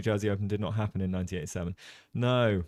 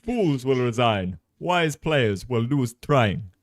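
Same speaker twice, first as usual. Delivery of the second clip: slow, louder attempt at an Italian English lilt; slow, louder mock Italian English quote; Italian English accent; 4 semitones higher, 9 dB louder, much slower than usual. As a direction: imitate the Italian coach line by line. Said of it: "really don't have the Italian accent".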